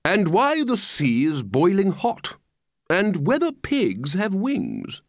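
slow, grandly drawn-out oratory; pompous walrus. Acted. Walrus lectures the oysters on many things, pompously. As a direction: list topics with sweeping, leisurely grandeur. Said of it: "the walrus said".

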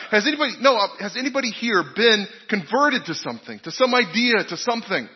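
lightly voiced lecturer pushing the question again; insistent professor. Professor repeats question to class.

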